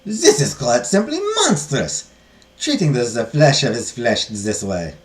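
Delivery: slow, emphatic condemnation; scandalized abbess; appalled nun. Acted. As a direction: draw it out in outraged character voice.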